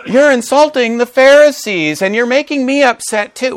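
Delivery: high-pitched, hammed-up indignation; mock upset. Someone butting in on an angry person, mock upset. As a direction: complain in a high, put-on huff. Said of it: "When somebody's angry and upset".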